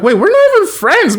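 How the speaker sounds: high startled protest; shocked squeak; incredulous objection. Retold or imitated. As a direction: balk at the idea. Tori objects to Jade's request.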